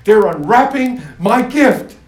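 loud, slow, high delight; excited God. Acted. God watching his gift unwrapped, excited. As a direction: savour each word with joy. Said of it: "he was excited".

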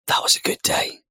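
whispered, content and hushed. Retold or imitated. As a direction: breathe it out in a satisfied whisper. Parent's satisfied thought after bedtime.

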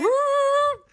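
very high, loud rewinding whoop; squealed mock rewind sound; imitated rewind noise. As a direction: mimic a tape whirring backwards, loudly.

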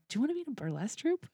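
high, fast, eager blurt; eager rushed question. Retold or imitated. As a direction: fire the question off in one breath. Nervous quick recruiting question.

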